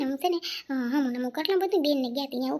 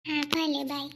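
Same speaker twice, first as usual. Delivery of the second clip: usual speaking voice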